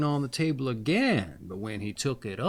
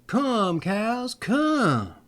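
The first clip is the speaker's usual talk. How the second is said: high, louder call across the field; calling to cows; 9 semitones higher, 4 dB louder, much slower than usual. A narrator's reading. Shepherd calling his runaway cows, high loud call. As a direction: call the herd home. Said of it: "He called".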